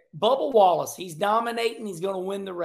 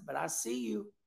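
playful whispered taunt; 4 semitones lower, 10 dB quieter than usual. Retold or imitated. Host whispers a friendly taunt at Bubba. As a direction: hiss it softly like a secret tease.

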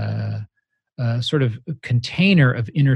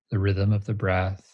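guided meditation. Acted. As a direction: guide them gently into stillness. Leads a meditation.

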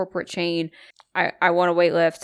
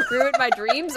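laughing; 9 semitones higher than usual. Real, not acted. Laughing repeat.

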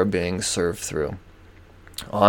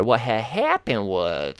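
mock cashier excuse; slower than usual. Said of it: "she said".

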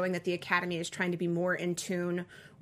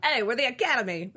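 louder, higher put-on character voice; Academy's voice; 5 semitones higher, 5 dB louder than usual. Asked how the snobby Academy sounds, mock voice. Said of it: "What's their voice sound like?".

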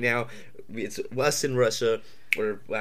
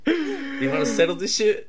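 tough guy challenge; 12 semitones higher than usual. Pretend. Challenging a rival president.

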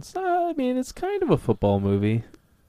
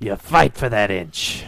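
Al Pacino speech; slower than usual. Retold or imitated. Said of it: "the speech at the end with Al Pacino".

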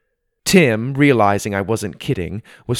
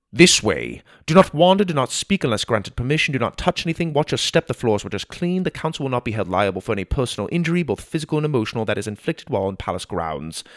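fast, rattled-off rules; chatty guard; faster than usual. Acted. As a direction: reel off the endless rules without a breath. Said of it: "the guard's never-ending words".

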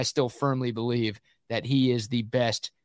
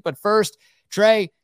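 louder, upbeat ad-read pitch; sponsor ad read; 6 semitones higher, 4 dB louder than usual. Acted. Host to co-host Trey, plugging AV Consultations in a louder upbeat ad voice.

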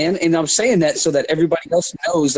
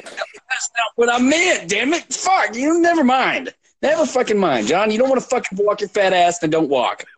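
exasperated outburst; 9 semitones higher than usual. Real, not acted. Argument with friend over challenge.

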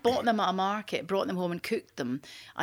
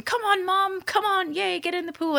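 high-pitched imitation of an eager son; mock childlike pleading, pitched well up; excited urging; 10 semitones higher than usual. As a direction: egg mum on like an excited kid. Son urging mum into the pool.

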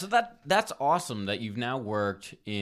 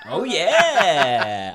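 laughing; 8 semitones higher, 12 dB louder than usual. Real, not acted. Laughing at carjacking capital joke.

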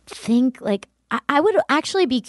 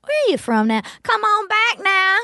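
Southern saloon call, pitched up at the end; 10 semitones higher than usual. Acted. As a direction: holler them back into the saloon. Continues the saloon act.

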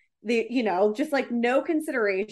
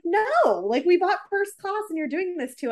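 indignant protest; 7 semitones higher than usual. Retold or imitated. Couple protesting first class downgrade.

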